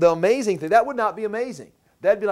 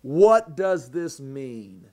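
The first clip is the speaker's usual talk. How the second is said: drawn-out, puzzled, a touch lower; slow bewildered question; 5 semitones lower, much slower than usual. Retold or imitated. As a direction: ask it slowly as if stunned. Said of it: "And they say".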